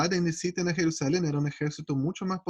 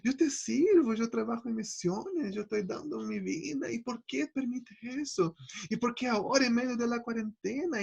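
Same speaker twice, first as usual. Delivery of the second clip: a mild imitated complaint to God; lamenting prayer; 6 semitones higher, 4 dB quieter than usual. He voices his own complaint to God, softly higher and pleading. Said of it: "lo que viene el pensamiento de uno".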